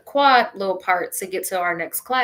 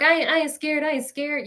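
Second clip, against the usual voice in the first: denying fear; 6 semitones higher than usual. Retold or imitated. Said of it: "I'm like".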